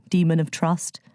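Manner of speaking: usual speaking voice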